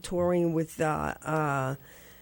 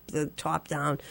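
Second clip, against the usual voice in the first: ordinary talk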